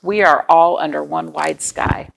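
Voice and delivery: usual speaking voice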